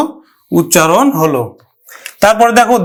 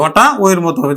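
usual speaking voice

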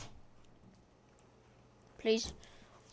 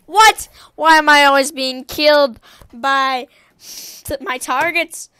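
loud cry of disbelief; loud frustrated complaint; exasperated protest; 8 dB louder than usual. Real, not acted. Killed again by target.

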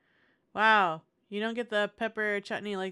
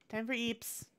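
ordinary talk, nothing marked

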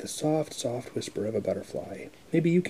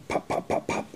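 whispered pops. Acted. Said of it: "He can sound like a cork".

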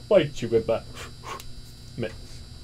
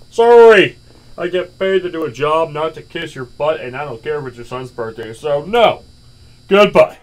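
angry refusal; 8 dB louder than usual. Acted. Cashier refuses begging customer.

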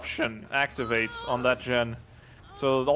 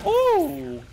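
high, loud held "ooh"; excited "ooh"; 5 dB louder than usual. Real, not acted. Co-caster reacting to the game, excited ooh. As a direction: whoop a sharp reaction to the play.

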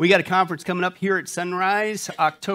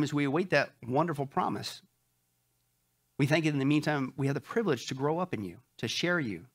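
softer, earnest prayer voice; opening prayer; 3 semitones lower, 4 dB quieter than usual. Real, not acted. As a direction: pray sincerely on behalf of the congregation. Pastor leads opening prayer.

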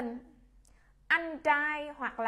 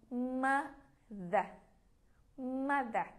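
ordinary delivery

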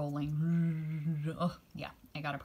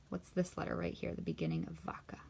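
whisper; 6 dB quieter, faster than usual. Shown as a demonstration. Teacher whispering a hint about vaca. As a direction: whisper the clue.

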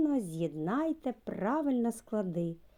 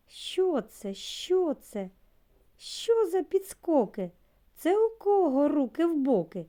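higher, bright mock astonishment at the hopping letter; playful surprise; 8 semitones higher, 4 dB louder than usual. Teacher to little kids about the dancing letter, mock surprise. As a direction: gasp in wonder like a puppet show host.

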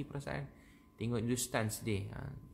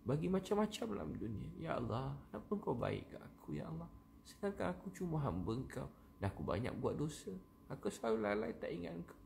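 heartfelt pleading prayer; 4 semitones higher than usual. Shown as a demonstration. Preacher confessing faults and thanking God, pleading prayer.

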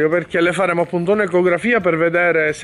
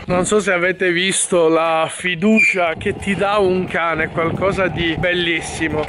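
ordinary talk

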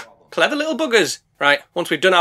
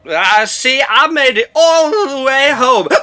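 put-on slow, sloppy drunken boast; drunk slur; 4 semitones higher, 5 dB louder, slower than usual. Drunk boat crashes into generator, slow slurred boasting.

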